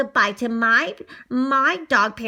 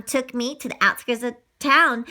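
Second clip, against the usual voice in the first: usual speaking voice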